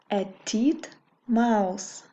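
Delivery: "A titmouse" said slowly and clearly; slow model word. Shown as a demonstration. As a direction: stretch out "A titmouse" for the learner. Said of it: "Repeat after me".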